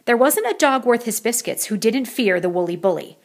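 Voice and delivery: ordinary delivery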